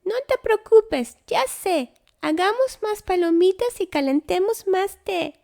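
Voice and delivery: very high little squeak, soothing; a tiny mouse calming the bear; squeaky mouse. A narrator's reading.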